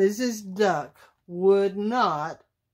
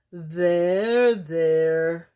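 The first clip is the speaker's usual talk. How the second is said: low, comforting wolf voice; drawn-out, lower-pitched reassurance; soothing; 4 semitones lower than usual. A narrator's reading. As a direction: console a tearful little one. Wolf comforts crying duckling.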